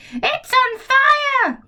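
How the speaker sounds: high, slow, shrill-pitched cry of alarm; alarmed grandma yell. Acted. Grandma yelling from the roof that the hen is on fire. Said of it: "Grandma yelled".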